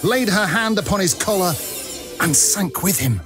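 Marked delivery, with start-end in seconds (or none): none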